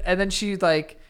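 ordinary talk

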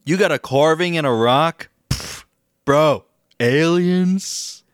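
a put-on doubter scoffing at aliens; mock disbelief. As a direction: sneer at the carving-in-a-rock crowd. Mocking the alien believers.

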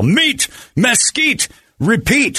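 slow, high, drawn-out ad read; announcer selling the slogan. Acted. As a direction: stretch the slogan like a jingle.

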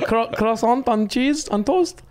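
high, slow mock French; French accent. Shown as a demonstration. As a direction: stumble slowly through a high French accent.

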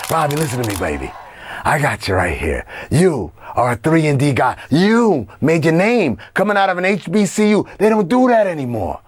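fast-talking Hollywood agent patter; Hollywood guy. Pretend. Host hyping Robbie as the Hollywood guy, put-on showbiz voice. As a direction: schmooze and hype like a Hollywood player. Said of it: "we also got the Hollywood guy".

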